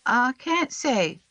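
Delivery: put-on high, slow little boy, refusing; a scared child holding back; very slow, high child's voice; Sam. A narrator's reading.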